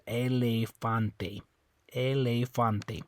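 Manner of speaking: ELEFANTE slowly, ending in a clear TEH; sounded final E. Shown as a demonstration. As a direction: spell out ELEFANTE syllable by syllable, slowly. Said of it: "still gonna make that A sound".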